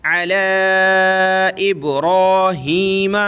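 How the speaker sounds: slow अला इब्राहीम with the vowel drawn out; long vowel. Shown as a demonstration. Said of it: "लाम को हम खींचेंगे". (खींच कर)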